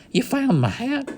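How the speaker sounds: high, delighted cowboy question; light up at the news of the hat; cowboy thrilled his lost hat is found; excited. A narrator's reading. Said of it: "jumped to his feet excitedly".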